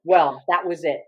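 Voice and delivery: ordinary talk, nothing marked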